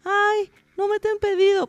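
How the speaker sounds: high, whiny mock complaint; whiny complainer. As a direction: mimic a whining seller. Mocking whiny complaining seller.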